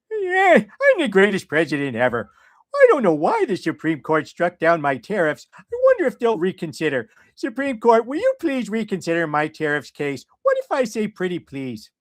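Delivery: dopey cartoon dad voice with a mock-Trump boast; Homer Simpson voice. Mocking Trump by reading him as Homer, in a cartoon dad voice. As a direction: play a dim, cheerful Homer begging the court. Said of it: "the next time I'll just try to do it as Homer".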